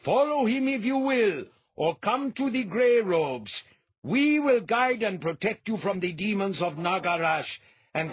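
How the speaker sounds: high, loud, booming prophet voice; a fanatic priest calling out to the masses, loud and high; thundering priest. A narrator's reading. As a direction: proclaim it to the crowd like a zealot. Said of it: "Behaid thundered".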